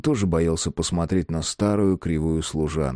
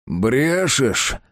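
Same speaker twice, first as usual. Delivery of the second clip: attempted growl; 6 semitones higher, 3 dB louder than usual. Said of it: "хочет прорычать".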